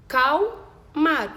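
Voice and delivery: "acalmar" slowly split into a, cal, mar; syllable by syllable. Shown as a demonstration. Teacher showing we split as we speak, "acalmar" syllable by syllable. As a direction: pause between each spoken piece of "acalmar". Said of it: "separar conforme eu falo".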